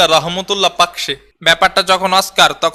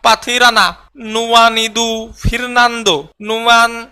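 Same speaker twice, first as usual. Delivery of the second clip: very slow, higher, stumbling over the names; stumbling name reading; 3 semitones higher, much slower than usual. Shown as a demonstration. A player fumbles through the Sri Lanka squad, slow and higher. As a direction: struggle syllable by syllable through each name. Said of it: "পইরা শোনা বেড়া".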